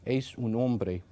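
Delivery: clumsy American accent on Es un hombre; halting phrasebook tourist. Shown as a demonstration. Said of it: "hear some of my terrible Spanish, I will try to record my voice".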